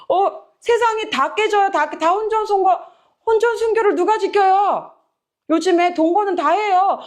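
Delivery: high-pitched, exasperated protest; protesting disciples. Retold or imitated. Disciples objecting that nobody keeps premarital purity, exasperated protest. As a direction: exclaim in comic disbelief, pitch held high. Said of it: "그러니까 제자들이 이르되".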